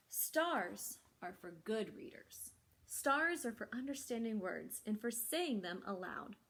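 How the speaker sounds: lively, expressive picture-book reading; reading to children. Acted. Librarian reads the story to children, animated storytelling. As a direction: bring each line to life for the kids.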